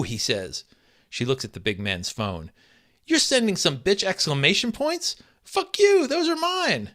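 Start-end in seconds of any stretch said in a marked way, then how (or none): 3.1-6.9 high, loud furious outburst; jealous wife yelling at her husband, high and loud. Acted. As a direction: scream it at the cheating husband. Wife furious about texts.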